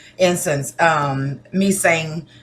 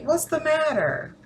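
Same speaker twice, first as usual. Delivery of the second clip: concerned question; 11 semitones higher than usual. Acted. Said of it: "People ever walk up to you and say".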